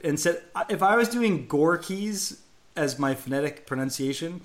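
ordinary talk

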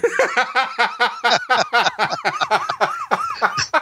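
extended high-pitched giggling fit; long high laugh. Real, not acted. Cohost laughing at a rude text reply, long high laugh. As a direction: keep laughing, high and helpless.

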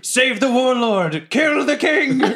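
high-pitched, drawn-out rallying call; rally the troops with long, high cries; yelled battle cry. Acted. Player yells to all the goblins from the bakery, rallying. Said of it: "I yell to all the goblins from the bakery".

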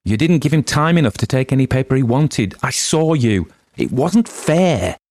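high, loud outraged cry; high, loud protest, slowing on the last words; shouting protest. A narrator's reading. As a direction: shout it at him as an injustice. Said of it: "Tessie Hutchinson shouted to Mister Summers".

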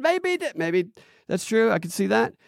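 rising uncertain upspeak. Shown as a demonstration. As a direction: end every phrase like a question. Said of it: "talking like this".

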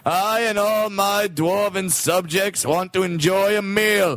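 a pompous king announcing his feast; grand singsong king; dwarf king. Acted. Dwarf king's courtly speech, sung.

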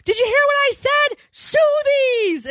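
high, exasperated call down the stairs; retold yell. Wife calling down the steps to husband, yelling. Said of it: "So I yell down the steps".

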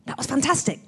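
excitable director, pitched high; upbeat, high imitation of a keen director; Danny Boyle. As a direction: cheer the actors on for another take. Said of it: "yeah, excitable".

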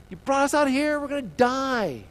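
high, loud wail of blame; mock angry outburst. Mad Israelites accusing Moses, hammed-up anger. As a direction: protest hotly, stretching the ending. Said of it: "They're mad".

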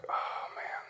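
hushed, sinking whisper; dismayed whisper. Retold or imitated. Preacher recalling news of a mother's death, dismayed whisper. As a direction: breathe it out under your breath in dismay.

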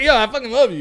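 loud, high, gushing love call; loud declaration. Retold or imitated. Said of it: "we're like".

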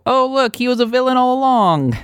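high, gasping soap opera reveal; soap opera drama. Retold or imitated. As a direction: play it like a melodramatic reveal. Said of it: "It's very soap opera".

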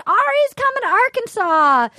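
high, singsong announcement; imitated contestant. Imitating a contestant announcing a visit, high singsong voice. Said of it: "then she goes".

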